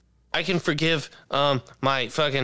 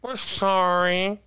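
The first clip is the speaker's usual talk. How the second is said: mocking whine; 6 semitones higher, much slower than usual. Echo in mocking voice.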